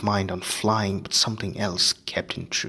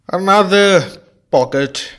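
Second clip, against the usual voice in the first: slow, higher, louder teacher voice; Lupin's voice; 10 semitones higher, 11 dB louder, slower than usual. Acted. Lupin to Harry, slow and higher. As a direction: Lupin announcing his find. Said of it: "said Lupin".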